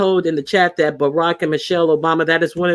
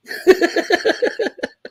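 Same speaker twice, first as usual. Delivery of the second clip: high, airy burst of laughter. Real, not acted. Laughing at her own dog story, high amused laugh. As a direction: crack up at your own joke.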